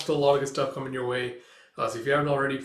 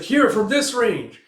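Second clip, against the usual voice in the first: high, loud and slow call; shouted order; 9 semitones higher, 9 dB louder, slower than usual. Retold or imitated. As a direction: bark the range out slowly, louder. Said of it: "It's like".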